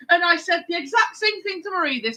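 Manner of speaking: put-on lightly done Bristol accent, chatty and matter-of-fact; a hint of a Bristol murderer chatting casually; Bristol man character. Host doing their Bristol killer character for Mike. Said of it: "a character that me and Sarah came up with".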